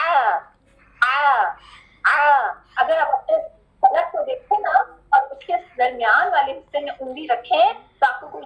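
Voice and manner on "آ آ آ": آ voiced from the middle of the throat with a squeeze; ayn sound demo. Shown as a demonstration. Teacher showing students where ayn vibrates, throaty. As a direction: press the آ from mid throat. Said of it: "اس کی sound کیسی ہے؟".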